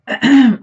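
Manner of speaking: sharp, loud rasp clearing a failing voice; loud throat clearing. Real, not acted. Apologizing to viewers, she clears her throat loudly. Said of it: "okay, my voice".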